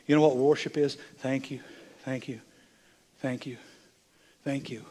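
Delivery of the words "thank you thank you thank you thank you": soft, unhurried thank-yous with pauses between; hushed worshipful thanks. Shown as a demonstration. Preacher showing the congregation what worship is, hushed thanks. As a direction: murmur it as a quiet prayer.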